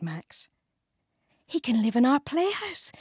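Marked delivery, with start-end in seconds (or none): 1.5-2.9 excitedly. Acted. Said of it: "said Jake excitedly".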